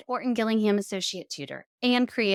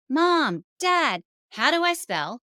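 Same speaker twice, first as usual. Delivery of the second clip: put-on whiny child; 7 semitones higher, 5 dB louder, slower than usual. Child asking parents for spelling.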